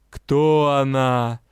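very slow, otherworldly question; a vast sea being peering at her; the wave asks the lord about the girl; curious ocean spirit. A narrator's reading. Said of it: "проявила любопытство ко мне волна".

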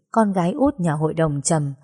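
usual speaking voice

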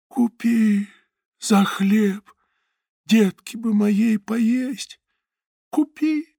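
high, slow pleading begging; starving mother begging a stranger to buy; desperate seller. A narrator's reading. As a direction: plead high for bread for her child. Starving woman selling towel.

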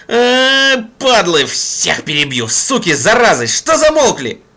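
raging howl at enemies; furious howl. Acted. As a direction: howl it out in mad fury. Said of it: "взвыл бешено Гурыня".

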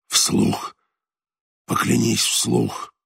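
slow, pressing dying voice; slow insistent demand. A narrator's reading. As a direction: press him to swear aloud. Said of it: "demanding oath, slow".

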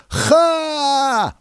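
short high scoffing "Ha"; mocking laugh. A narrator's reading. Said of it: "услышал я вслед".

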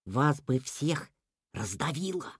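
slow, soft, fearful rabbit; whispering. A narrator's reading. Rabbit warning the animals of disaster, whispered. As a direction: whisper it slowly, fading away. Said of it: "прижал уши к голове и прошептал".

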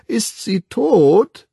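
put-on very high, slow, childish question; child's voice. A narrator's reading. A twin asks the Savage about the dead woman, in a child's voice. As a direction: ask with blank curiosity like a small child.

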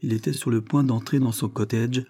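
plain reading voice

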